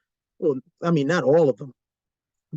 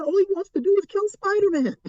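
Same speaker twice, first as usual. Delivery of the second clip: ordinary talk, nothing marked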